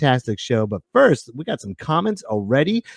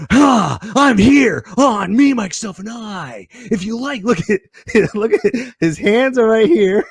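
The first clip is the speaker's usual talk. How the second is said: a hint of Nicolas Cage, higher and laughing; Nicolas Cage impression again; 6 semitones higher, 3 dB louder than usual. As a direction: ham up the Cage voice, laughing through it. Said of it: "since you want Nicolas Cage".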